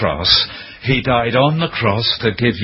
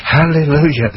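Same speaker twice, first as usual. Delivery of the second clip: joyful hallelujah; 5 semitones higher, 4 dB louder than usual. Real, not acted. Joyful exclamation.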